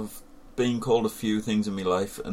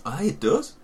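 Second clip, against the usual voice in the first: surprised; 10 semitones higher than usual. Real, not acted. Still reacting to the taste.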